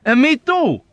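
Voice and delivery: high, loud happy chime-in; rejoicing. A narrator's reading. Fellow patient rejoicing at going home. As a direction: join the joy eagerly.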